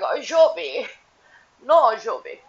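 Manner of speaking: high, loud imitation of Yara's accent; hammed-up thick-mouthed accent; mock mouth-full accent. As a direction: send up her accent, scolding with a full mouth. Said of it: "sounds like there's something in her mouth".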